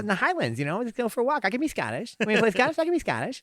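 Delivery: high-pitched talk-show host impression; chatty high voice playing at Scottish; Jay Leno. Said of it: "You can do fucking Jay Leno".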